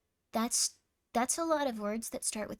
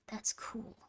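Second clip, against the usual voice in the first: "That's cool" in a soft whisper; 9 dB quieter than usual. Real, not acted. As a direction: breathe it out in a whisper. Whispered aside.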